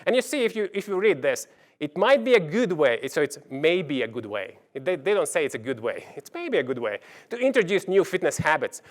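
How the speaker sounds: ordinary delivery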